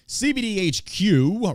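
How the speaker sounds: high, slow announcer read of the name; hyped announcer call. Acted. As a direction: sell the show name like an emcee.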